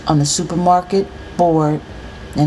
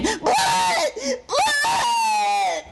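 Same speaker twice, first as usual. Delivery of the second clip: very high scream, held back in volume; child screaming; 5 dB quieter than usual. A narrator's reading. Little brother sees his own blood, screaming. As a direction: shriek it like a terrified small boy. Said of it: "that's when he really starts screaming".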